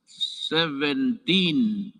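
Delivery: SEVENTEEN said very slowly, syllable by syllable; drawn-out correction. Real, not acted. Speaker corrects a misread figure to the audience, "seventeen" drawn out slowly. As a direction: stretch out "seventeen" deliberately to fix the slip.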